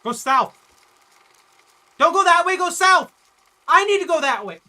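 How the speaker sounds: loud, urgent, high shout. Real, not acted. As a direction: yell directions in a panic. Urging while fleeing monster.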